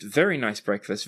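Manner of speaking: ordinary talk, nothing marked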